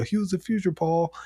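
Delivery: lightly imitated American taunt; Oba's switch quote. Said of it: "the Nigerian accent to the".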